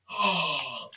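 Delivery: ordinary delivery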